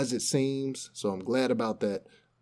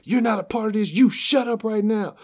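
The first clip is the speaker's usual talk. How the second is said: high, loud cop barking orders; hammed-up furious cop shout; mock angry cop yell; 11 semitones higher, 8 dB louder than usual. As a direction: yell it like a cop losing his temper. Cop yelling at filming guy.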